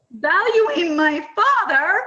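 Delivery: high, loud and drawn out through laughter; laughing exclamation. Retold or imitated. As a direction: exclaim it slowly, cracking up. Laughing punchline in funny anecdote.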